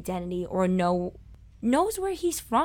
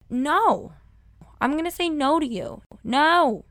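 higher, forceful, emphatic no; standing up to doubters, raised voice; defiant refusal; 7 semitones higher, 5 dB louder than usual. Real, not acted. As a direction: push back firmly, each "no" punched. Defiant answer to those who doubted her.

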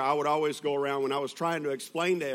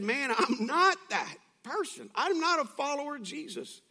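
indignant denial; 12 semitones higher than usual. Acted. Peter denying he follows Jesus, high mock protest. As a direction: act out a denial, pitched way up.

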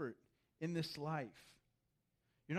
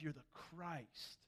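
softer and slow, the light bulb going on; slow hushed realization; 2 semitones lower, 10 dB quieter, slower than usual. Retold or imitated. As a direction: draw it out quietly. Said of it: "and he's like".

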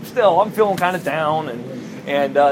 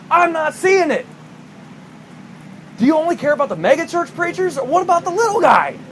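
yelling at God; 6 semitones higher than usual. Retold or imitated. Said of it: "I just yelled at God".

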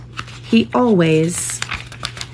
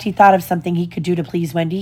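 usual speaking voice

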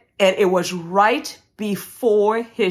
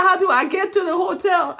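high-pitched mock wail; hammed-up helpless pleading; retold distressed plea; 13 semitones higher than usual. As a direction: whine the question, much higher. Retold tearful pleading with airline agent.

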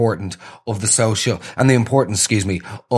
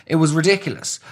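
exasperated; 7 semitones higher than usual. Real, not acted. Exclaiming about awkwardness.

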